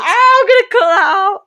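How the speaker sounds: high, loud laughing protest; half-laughing shout, pitched right up; laughing outcry. Real, not acted. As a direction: blurt it loudly through laughter. Jokey outcry over her own slip.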